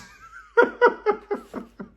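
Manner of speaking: high loud laugh. Real, not acted. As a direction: burst out laughing. Breaks up mid impression.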